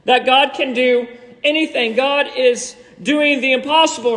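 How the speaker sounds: ordinary delivery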